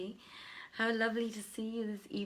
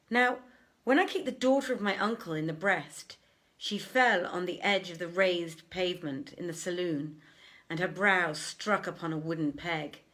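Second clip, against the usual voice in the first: louder, measured storytelling read; louder dramatic reading of the tale; story narration; 5 dB louder than usual. A narrator's reading. Reads the tale aloud.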